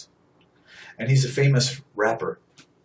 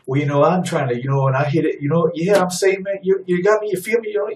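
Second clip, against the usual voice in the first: higher, louder rambling caricature full of filler; mocking idiot talk; 6 semitones higher, 4 dB louder than usual. Preacher imitates the talk he condemns, mocking idiot voice. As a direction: climb in pitch as you babble, mocking the speech. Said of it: "Why do you talk like an idiot?".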